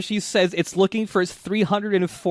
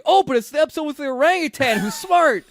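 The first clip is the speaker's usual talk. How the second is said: high, louder gushing about the smart ape; excited recollection; 10 semitones higher, 4 dB louder than usual. Retold or imitated. Cohost remembering his favorite episode, high gushing delight. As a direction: gush with delight.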